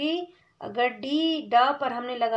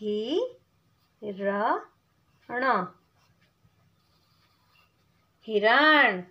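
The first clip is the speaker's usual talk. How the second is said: sounding out syllables; 2 semitones lower, much slower than usual. Shown as a demonstration. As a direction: spell it out then land the word. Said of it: "कैसे लिखेंगे?".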